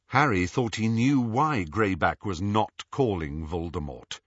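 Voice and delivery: plain reading voice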